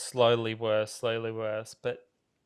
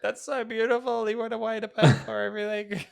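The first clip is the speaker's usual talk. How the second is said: moved gushing; 9 semitones higher than usual. Retold or imitated. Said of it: "And now I'm like".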